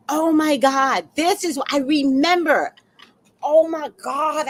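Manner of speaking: high, excited recollection; sudden realization. Real, not acted. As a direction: exclaim as the memory comes back.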